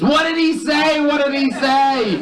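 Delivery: very high-pitched screech of a wife; hammed-up high wifely yell. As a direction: yell it in a piercing falsetto, dragging the end. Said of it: "She yells out once more".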